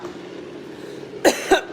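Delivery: sudden dry cough; coughing fit. Real, not acted. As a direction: cough as spicy fumes catch. Spicy fumes from the blender.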